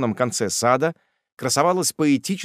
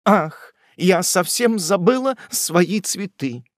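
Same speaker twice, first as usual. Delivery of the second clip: high, slow, fluttering aunt voice; lady remembering her flowers, raised and drawn out; spinster aunt's exclamation; 8 semitones higher, 5 dB louder, slower than usual. Acted. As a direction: gasp it out like a flustered spinster.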